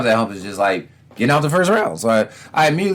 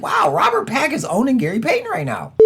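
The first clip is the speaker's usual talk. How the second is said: mock amazement; 10 semitones higher than usual. Fans quoted in mock amazement.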